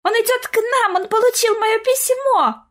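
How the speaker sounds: put-on high-pitched, overjoyed little girl; breathless child's rapture; gushing delight. A narrator's reading. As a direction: exclaim as if bursting with delight. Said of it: "захлёбываясь от восторга, воскликнула девочка".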